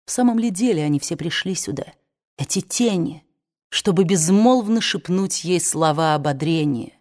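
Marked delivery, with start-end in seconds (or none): none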